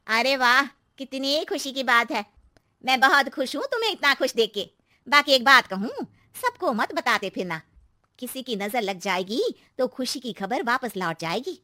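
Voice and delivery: gush, then caution her; gushing neighbour aunty. Acted. Narrator voices neighbour aunty. (पहले खुश, फिर चेताती aunty)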